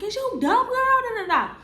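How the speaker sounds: high incredulous mocking; mock boyfriend. Boyfriend mocking her, high scoffing voice.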